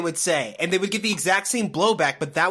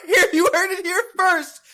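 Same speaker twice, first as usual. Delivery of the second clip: laughing shout; 6 dB louder than usual. Real, not acted. Mock proclamation, laughing.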